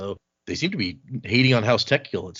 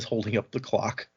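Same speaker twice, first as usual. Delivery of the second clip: usual speaking voice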